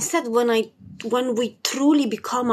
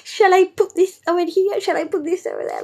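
put-on high, rising childlike questions; like a baby; 9 semitones higher than usual. As her inner child while designing, high babyish questions. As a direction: play an excited little child choosing colours. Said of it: "like a baby".

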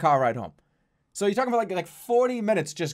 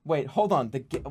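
ordinary delivery

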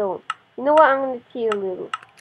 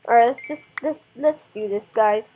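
ordinary delivery

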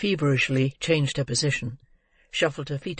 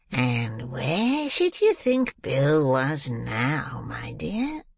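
conciliatory; much slower than usual. A narrator's reading. Old man sounding out the girl about Bill, conciliatory. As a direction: coax gently, drawing the words out. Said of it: "said in his most conciliatory tone".

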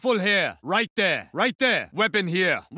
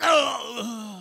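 big laugh; 7 semitones higher than usual. Acted. Triumphant laugh after close call.

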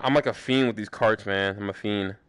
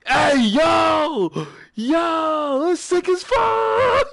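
excited yelling; 3 dB louder, much slower than usual. Real, not acted. Excited discovery of a huge cave.

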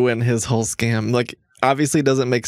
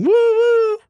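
train whistle yell; 4 dB louder than usual. Retold or imitated. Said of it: "driving around my apartment yelling".

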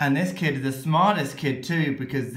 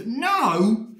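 exasperated no; 7 semitones higher, 4 dB louder than usual. Acted. Reader refuses, exasperated.

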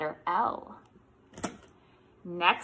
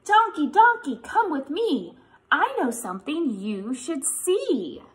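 sing-song read-aloud voice, higher; reading to children; 6 semitones higher, 5 dB louder than usual. Acted. Teacher reads the picture book refrain to kids, sing-song.

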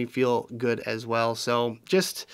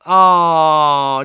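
touched aww; 2 semitones higher, 11 dB louder than usual. Real, not acted. Touched by a sweet message.

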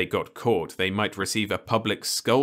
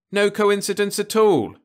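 much higher, louder curt reply; short; 14 semitones higher, 8 dB louder than usual. Acted. Ludovic curtly shuts down the uncle's rumour. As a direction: cut him off. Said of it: "said Ludovic shortly".

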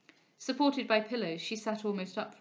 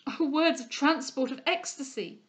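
enraptured; 5 semitones higher, 5 dB louder than usual. A narrator's reading. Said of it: "cried the enraptured Delvile".

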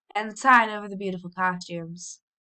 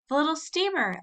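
excited cry; 8 semitones higher than usual. A narrator's reading. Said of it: "everybody cried".

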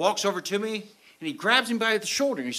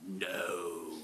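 dismayed; 12 semitones lower, 14 dB quieter than usual. Retold or imitated. Finds his license is gone.

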